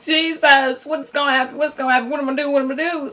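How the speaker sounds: high, frantic mock panic; half-comic panic, calling on Jesus; panicked. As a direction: act out a panicked prayer. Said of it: "At this point, I'm like".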